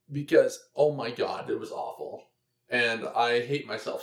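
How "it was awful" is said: unvoiced, disgusted whisper; hushed grossed-out whisper. Real, not acted. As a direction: breathe out the horror.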